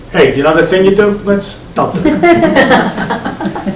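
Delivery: put-on slow, drawn-out Long Island accent, laughing through it; a wife's Long Island accent, played for laughs; teasing Long Island accent with a laugh. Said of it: "in her Long Island accent".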